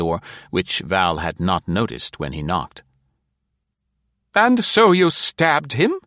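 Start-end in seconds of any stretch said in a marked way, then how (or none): 4.3-5.9 higher, slow pointed matron question; wide-eyed query. A narrator's reading. Nora questioning the stranger about the stabbing, wide-eyed. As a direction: ask it innocently but pointedly. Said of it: "Nora queried, wide-eyed".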